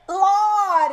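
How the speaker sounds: high, loud "Lord"; drawn-out, scandalized high holler; shocked exclamation. Real, not acted. Shocked at the letter.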